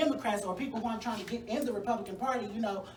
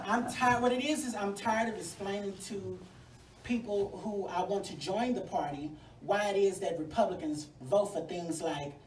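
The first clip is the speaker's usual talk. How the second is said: ordinary talk, nothing marked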